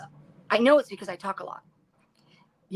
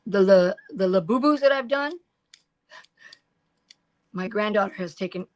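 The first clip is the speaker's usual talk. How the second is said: ordinary delivery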